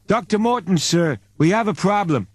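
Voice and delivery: a worried subordinate reporting; Apton Sticks voice. Acted.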